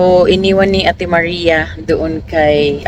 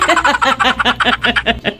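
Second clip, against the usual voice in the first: high, bubbling laughter; 6 semitones higher than usual. Real, not acted. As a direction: laugh on after the goodbye. Laughing after goodbye. (mataas na tawa)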